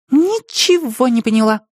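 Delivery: put-on high girl's puzzled voice; girl confessing confusion; honest admission. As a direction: admit you're lost. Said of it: "честно призналась Катя".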